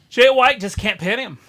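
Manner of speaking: loud excited call. Real, not acted. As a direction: shout it like a ringside fan. Calling live wrestling action.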